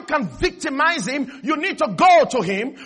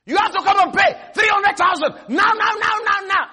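higher, louder, pressing demand; pushy debt collector; 7 semitones higher, 4 dB louder than usual. Retold or imitated. Preacher acting the creditor calling about the debt, loud and pushy. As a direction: bark it like a bill collector on the phone.

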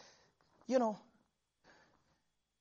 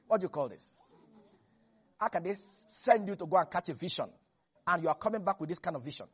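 ordinary delivery

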